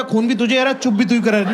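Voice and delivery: usual speaking voice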